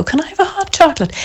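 put-on high, quick, eager kid voice; eager little boy. Impulsive son chiming in to ask mum for hot chocolate, high and eager. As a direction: pipe up like a child wanting a treat. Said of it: "And he'll go".

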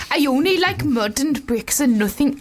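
lightly put-on Welsh accent. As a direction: read it in a light Welsh lilt. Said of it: "let's try it in a Welsh accent".

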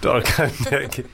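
chuckling through the echo; laughing repeat. Real, not acted. Echoing the partner's joke.

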